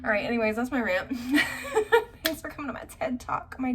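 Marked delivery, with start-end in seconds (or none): 1.2-2.2 high laugh. Real, not acted. Laughing after her rant.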